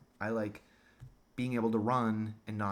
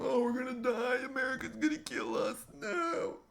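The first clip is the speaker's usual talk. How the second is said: much higher, frightened mock whine ending in a cry; mock panicked whimper. Imagined Germans fearing America, high mock-panicked whine. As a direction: whimper like soldiers losing the war. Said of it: "Imagine the Germans are going".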